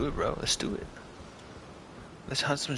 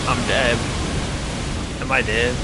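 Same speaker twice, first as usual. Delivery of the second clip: loud, stunned outburst; raging gamer; 4 dB louder than usual. Real, not acted. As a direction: yell in disbelief. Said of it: "gamer dying in fight".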